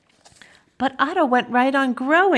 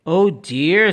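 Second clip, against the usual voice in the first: put-on lowered older-gentleman dismay; Mister Carp; 4 semitones lower than usual. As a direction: sigh it lower, in concern. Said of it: "said Mister Carp".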